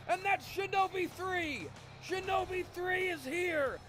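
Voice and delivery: high, slow ring announcer roar; hype announcer. Acted. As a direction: hype the crowd for a hardcore entrance. Ring announcer hypes new entrant.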